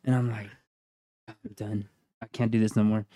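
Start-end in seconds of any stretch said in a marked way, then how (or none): 1.3-3.1 very soft, low and beaten; quiet defeat. Retold or imitated. Man beaten by ghost pepper snack, quietly giving up. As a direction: give up under your breath.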